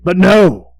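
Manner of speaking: sharp loud "no"; emphatic. A narrator's reading. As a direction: punch the denial hard. Narrator's emphatic denial.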